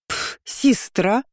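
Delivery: scoff, then draw out the word with distaste; disgusted. A narrator's reading. Curly-haired girl rejecting her sister, disgusted. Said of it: "Столько омерзения слышалось в голосе".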